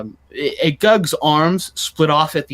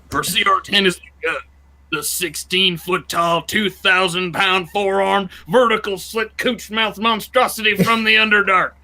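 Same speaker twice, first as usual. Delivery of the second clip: ringside announcer voice; 5 semitones higher than usual. Shown as a demonstration. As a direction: hype the monster like a title bout. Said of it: "It's just my ringside announcer voice".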